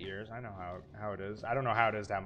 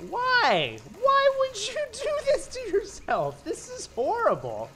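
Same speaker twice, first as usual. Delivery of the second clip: high, loud wail of disbelief; panicked shout; 11 dB louder than usual. Real, not acted. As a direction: cry out in horror. Panicked horror reaction.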